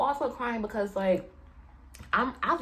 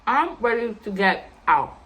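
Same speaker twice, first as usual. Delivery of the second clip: slow, emphatic, louder announcement; irritated; 6 dB louder, slower than usual. Retold or imitated. Irritated woman wanting out of the club, slow and emphatic. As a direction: spell it out slowly, fed up. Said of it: "I'm irritated the entire night".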